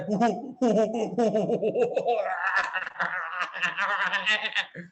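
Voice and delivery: hooting "ho-ho" turning into a long rattling laugh; character laugh. Shown as a demonstration. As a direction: open with hoots, then run on into a long laugh. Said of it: "कोई किरदार अगर है वो ऐसे हंसता है". (किरदार वाली हो-हो हंसी)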